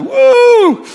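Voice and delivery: sudden high whoop. Retold or imitated. Audience member whoops.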